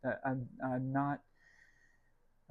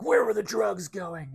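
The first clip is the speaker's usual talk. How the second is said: loud, slow put-on Batman growl; 6 semitones higher, 9 dB louder than usual. Scaring preschool children, loud slow Batman interrogation. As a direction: interrogate like a gravel-voiced Batman. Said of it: "a Batman voice that I use sometimes".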